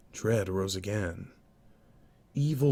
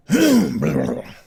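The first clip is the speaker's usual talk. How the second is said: throat clearing; 11 semitones higher, 11 dB louder than usual. Real, not acted.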